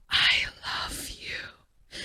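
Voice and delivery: soft, slow whisper of delight; whispered love. Acted. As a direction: breathe it like a secret. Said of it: "And here's me".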